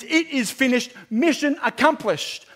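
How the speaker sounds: triumphant mission accomplished. Shown as a demonstration. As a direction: announce it like a victory. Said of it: "It means".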